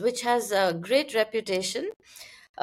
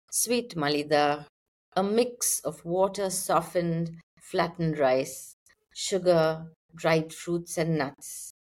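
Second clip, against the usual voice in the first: poetry reading; 5 semitones lower than usual. Acted. Poet reads the title poem for podcast listeners, measured poetry reading. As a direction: read the poem out line by line, unhurried.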